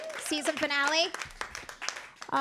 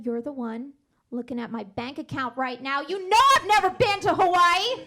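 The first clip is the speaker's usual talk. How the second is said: exasperated retort; 7 dB louder than usual. Retold or imitated. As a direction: snap back at the nosy teller, loud. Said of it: "And he said".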